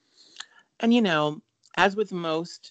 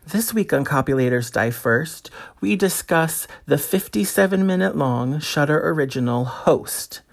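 podcast intro read; 7 dB louder than usual. Acted. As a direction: read it like a show opener.

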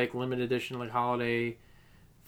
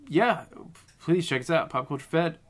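ordinary talk

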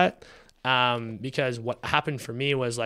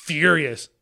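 louder, blunt angry bursts; mad venting; 2 semitones higher, 8 dB louder, slower than usual. Retold or imitated. Venting to himself about the supplier, mad. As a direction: spit out each short line in a rage. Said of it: "I'm furious".

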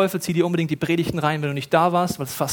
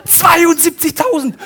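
high, loud and slow, stretching the number; awed emphasis; 11 semitones higher, 7 dB louder, slower than usual. Acted. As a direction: let the huge number ring out in awe. Zahl der Engel im Stadion. (ehrfürchtig betont, hoch, laut und langsam)

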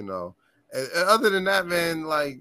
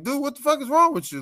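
fast, much higher, louder mock yell; high fast indignant shout; angry driver; 10 semitones higher, 5 dB louder, much faster than usual. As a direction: yell at the drunk like the driver did.

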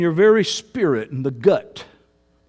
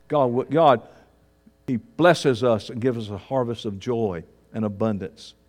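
ordinary delivery

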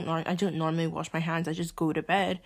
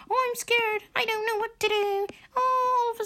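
very high, whiny mock-frightened voice; mocking scared child. Sister mocking sibling who backs down, high childish whine. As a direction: make fun of a scared little kid, squeaky.

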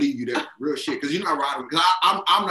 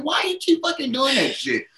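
mock yell retold; 11 semitones higher, 3 dB louder than usual. Retold confronting date about jaw.

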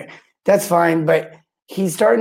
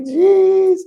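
a high, louder fed-up "Jeez"; exasperated jeez; 4 dB louder than usual. Retold or imitated. Recalling the handyman's failed repairs, a high exasperated "Jeez". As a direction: throw up your hands, high and loud.

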